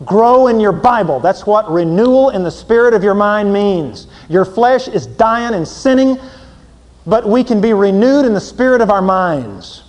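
loud, high preaching; fervent pulpit voice. Acted. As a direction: hammer the point to the congregation. Preaching at full force.

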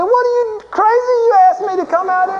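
very high, squawked protest; hammed-up panicked complaint to the one who invited you; outraged disbelief. Rafter turning on the pastor behind him, outraged disbelief.

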